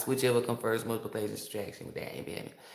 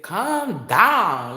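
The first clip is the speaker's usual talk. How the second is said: a drawn-out, higher, louder imitation of the man from New Orleans; smooth calm down; 8 semitones higher, 10 dB louder than usual. Imitating the New Orleans man telling everyone to relax. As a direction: mimic his silky calm down. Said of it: "the way he said it".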